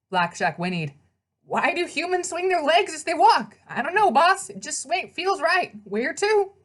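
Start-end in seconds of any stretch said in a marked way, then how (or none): 1.4-6.5 high, brash horse voice with a street twang; pegasus voice. Acted. The pegasus greets his rider in a high, brash horse voice. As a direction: play the cheeky winged horse, all swagger.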